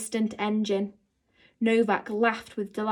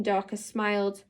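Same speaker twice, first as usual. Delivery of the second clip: usual speaking voice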